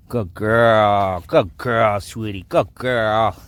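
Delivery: loud, gushing praise; excited praise. Real, not acted. Owner praising the dog right after she lies down, loud and gushing. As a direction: reward her with your voice.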